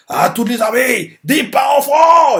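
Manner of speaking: high, slow, loud order; commanding shout. Retold or imitated. General ordering all armies forward, shouting. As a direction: bellow the order to every army. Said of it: "il me continue en disant".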